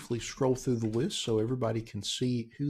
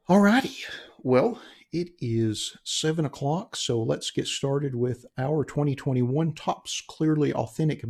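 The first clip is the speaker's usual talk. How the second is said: slow, drawn-out host intro; announcer voice; 3 semitones higher, slower than usual. Acted. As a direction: announce the break like a show host. Opens the break like a host.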